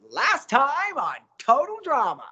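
high, bright show opener; bright host intro. Shown as a demonstration. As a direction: brighten up your eyes and announce it. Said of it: "brighten up your eyes and go".

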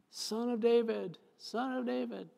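high, slow pleading cry of the leper; leper's plea. Retold or imitated. Leper begging Jesus for mercy, high pleading call.

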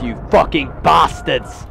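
angry yell at the enemies, slowed; frustrated shout. Real, not acted. Gamer yelling at enemy players who camp, angry shout.

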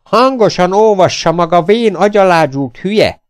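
loud, high furious bellow; ordítás. A narrator's reading. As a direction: roar it into his ear. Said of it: "bele nem ordítja a fülébe". (dühösen fülébe ordítva)

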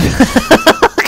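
very high rising squeal of laughter. Real, not acted. Gamer delighted after revive, squealing laugh.

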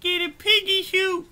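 lightly voiced cartoon pig boss; a touch of swagger, piggy character voice; self-important pig. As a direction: hold forth as a pompous puppet pig.

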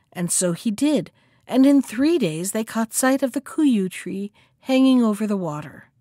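ordinary delivery

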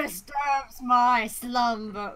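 slow booming cave guardian voice; grinding stone statue. Pretend. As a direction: rumble it out like an ancient stone. Cave of Wonders joke voice.

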